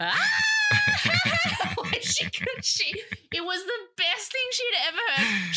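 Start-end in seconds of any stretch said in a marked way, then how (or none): 0.0-2.0 high, loud shriek breaking into laughter; screaming laugh. Retold or imitated. Imitating the nurse who could not stop laughing, screaming laugh. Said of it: "She was screaming".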